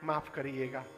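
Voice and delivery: soft, low acted apology; hushed, humble thief excusing himself; mock sheepish apology. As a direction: apologise quietly, half in jest.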